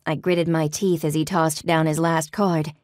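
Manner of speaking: usual speaking voice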